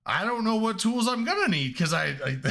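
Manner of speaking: half-shouted defence, tripping over words; flustered shout. Retold or imitated. Retold defensive answer to guard.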